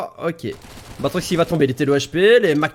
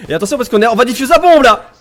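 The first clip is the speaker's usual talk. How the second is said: louder, higher, urgent commentary; excited play-by-play call; 7 semitones higher, 8 dB louder, faster than usual. Real, not acted. Esports caster warning as the bomb comes into play, excited call. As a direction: build to a raised, urgent shout.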